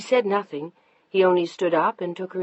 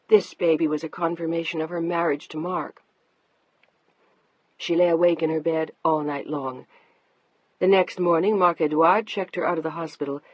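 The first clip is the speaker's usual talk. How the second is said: plain reading voice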